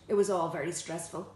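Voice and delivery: usual speaking voice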